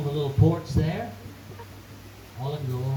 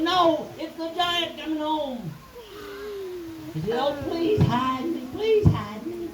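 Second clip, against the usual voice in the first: frightened pleading; 11 semitones higher than usual. Acted. Scared Jackie begs to hide.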